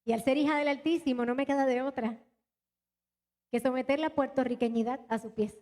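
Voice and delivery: usual speaking voice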